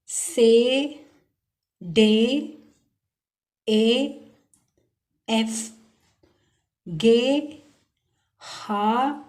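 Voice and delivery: each letter said slowly and clearly in German, one by one; careful German letter names, well spaced; German alphabet recital. Shown as a demonstration. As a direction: spell out the alphabet like a teacher. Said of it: "तो start करते हैं।".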